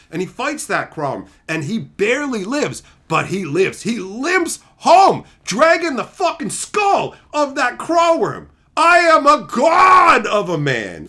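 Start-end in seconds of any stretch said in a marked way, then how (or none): 3.8-11.1 slow, high, loud heroic proclamation; triumphant epic boast. Acted. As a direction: crow victory like a bloodied hero. Epic tale of knight's triumph.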